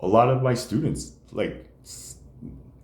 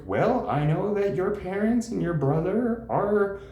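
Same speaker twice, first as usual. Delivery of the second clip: mock counselor; 11 semitones higher, slower than usual. Counselor voiced mockingly.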